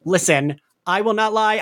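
ordinary talk, nothing marked

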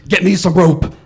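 loud, flat command; commanding order. Retold or imitated. Woman ordering her neighbor to fetch rope, loud command. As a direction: bark the order without missing a beat. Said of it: "she turned to me and she goes".

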